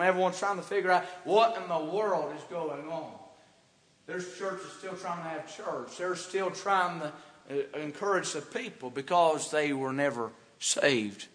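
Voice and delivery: normal talking voice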